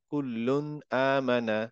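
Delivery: কুল্লুন আমানা drawn out slowly, double l held; slow careful recitation of কুল্লুন আমানা; slow correct recitation. Shown as a demonstration. Teacher models the correct Quran reading slowly. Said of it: "এরকম হবে না".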